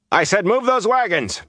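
high-pitched, impatient shouted order; snapping. A narrator's reading. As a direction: snap the order at dawdling men. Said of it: "He snapped at the men".

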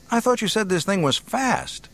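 high-pitched, challenging jibe; sarcastic. A narrator's reading. Luke taunting Solo about his ship, high and sarcastic. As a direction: throw it back at him, pitched well up. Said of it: "Luke asked sarcastically".

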